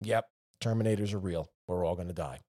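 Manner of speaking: low, soft deadpan doom; mock doom. Viewer of an AI deepfake video, deadpan quiet doom. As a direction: mutter it like you've given up hope. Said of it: "you watch the video like".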